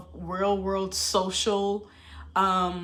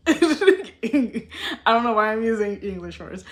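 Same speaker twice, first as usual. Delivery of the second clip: laughing; 7 dB louder, slower than usual. Real, not acted. Laughs at own accent.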